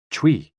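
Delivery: "chui" as one high, loud, falling burst; forceful "chui". Shown as a demonstration. Narrator demonstrates the kidneys' healing sound, "chui" pushed out high and forceful. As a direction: push "chui" out hard on the breath. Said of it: "with a forceful exhale".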